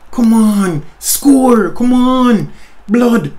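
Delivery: will the striker to score; urgent pleading. Real, not acted. Gamer urging his player to finish, loud high pleading.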